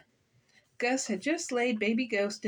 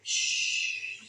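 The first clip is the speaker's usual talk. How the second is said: long unvoiced shush; quieting shush; hushing. A narrator's reading. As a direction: hush them with a drawn-out "shh". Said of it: "said Gus".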